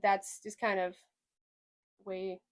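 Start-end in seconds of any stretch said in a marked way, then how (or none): none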